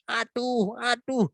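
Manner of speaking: high-pitched "ow, ow" without real volume; mock pained cry. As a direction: imitate someone yelping from a toothache. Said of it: "makanya dia teriak".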